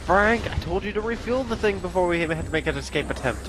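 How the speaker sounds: high, exasperated pirate scolding. Pretend. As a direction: scold him like a fed-up boss. Voicing pirate scolding Frank.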